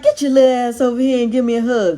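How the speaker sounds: playful hollered demand, imitated; loving grandpa-in-law. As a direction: imitate a rough-but-loving grandpa calling out. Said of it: "He was saying it lovingly".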